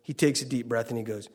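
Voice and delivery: ordinary delivery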